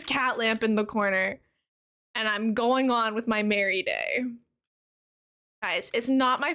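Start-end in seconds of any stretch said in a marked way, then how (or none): none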